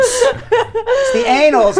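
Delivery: high, slow drawn out "annals" with Brooklyn a; Brooklyn accent. Shown as a demonstration. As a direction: stretch "annals" in mock Brooklyn. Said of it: "I sound like I'm from Brooklyn".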